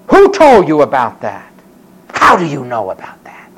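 low, forceful startled questioning; startled demand. Acted. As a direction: demand it as if caught out. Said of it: "And the guy stopped and said".

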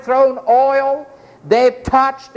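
normal talking voice

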